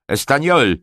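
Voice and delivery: sudden loud, high shout; nagły krzyk. A narrator's reading. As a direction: yell for someone across the yard. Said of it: "krzyknął znienacka". (nagły, donośny okrzyk)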